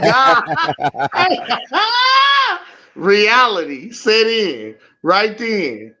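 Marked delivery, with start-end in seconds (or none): 0.5-2.6 high, drawn-out cackle; long uproarious laugh; real belly laugh. Real, not acted. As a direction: burst out laughing helplessly. Listener cracks up at the story.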